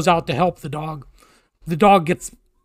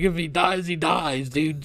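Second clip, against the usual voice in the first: retold indifference; 6 dB quieter than usual. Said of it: "She didn't care".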